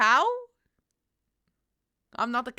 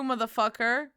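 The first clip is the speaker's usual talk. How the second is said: normal talking voice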